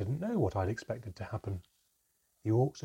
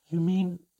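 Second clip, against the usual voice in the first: gasped; 10 semitones higher, 9 dB louder than usual. Acted. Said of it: "I gasped".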